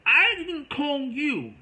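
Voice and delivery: very high, loud, drawn-out outcry; shocked protest. Retold or imitated. Wrongly blamed for calling, loud high shocked protest. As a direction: reenact stunned indignation at full volume. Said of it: "I was shocked".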